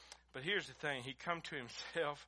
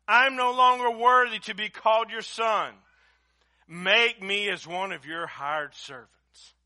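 loud, high, impassioned preacher's cry; fiery preaching; 6 semitones higher, 16 dB louder than usual. Acted. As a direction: preach it at full force, pleading. Preaching at full force.

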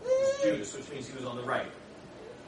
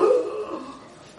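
gasp; 10 semitones higher, 7 dB louder than usual. Acted. Preacher acts out shock at transfiguration.

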